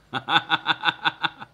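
loud rapid cackle; real belly laugh; hearty laugh. Real, not acted. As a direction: burst out in a long staccato laugh. Amused at his stream.

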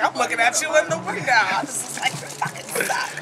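usual speaking voice

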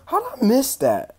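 high, loud burst of disbelief; sudden loud surprise; surprised shout. Real, not acted. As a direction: yelp at the screen in shock. Shocked at game replay.

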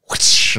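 sudden mimed whoosh sound. Retold or imitated. Co-host acts out an official whacking someone, imitated whoosh. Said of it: "he just goes".

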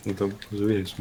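ordinary delivery

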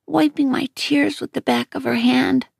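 ordinary delivery

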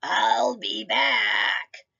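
slow, lightly voiced villain; a hint of a movie villain; menacing germ voice. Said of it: "the germ ... said".

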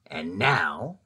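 low, sly Grinch voice with a grin; lightly voiced sly Grinch; grinning Grinch. A narrator's reading. Grinch grinning to himself over his plan, low and sly. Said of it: "grinned the Grinch".

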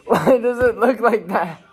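laughing while asking; giggling in disbelief. Real, not acted. Repeats, laughing.

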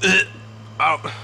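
strained voice from a burning throat. Real, not acted. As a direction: talk through a stinging throat. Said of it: "my throat's melting".

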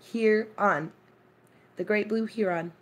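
"Huron" and "heron" both HEE-ron; spaced out, long ee in "heron", HEE-ron; HEE-ron pronunciation. Shown as a demonstration. Modelling it for viewers, "heron" as HEE-ron. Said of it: "You say heron".

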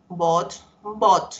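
"bought" with silent GH, just a clear T at the end; bought said as bot. Shown as a demonstration. Teacher modelling the verb for her class, "bought" said bot. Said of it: "se lee solo como una T".

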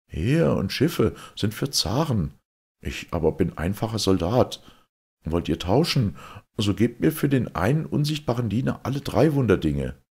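a plain soldier bargaining firmly, pushing for all three; soldier Fedot. Acted.

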